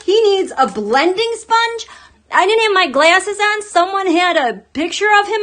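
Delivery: high, loud laughing outburst; joking about his makeup, excited; excited rant. Real, not acted. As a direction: gush it out, laughing.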